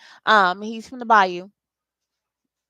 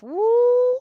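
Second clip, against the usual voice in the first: excited whoop; 13 semitones higher, 4 dB louder than usual. Real, not acted. Reacting to drama reading.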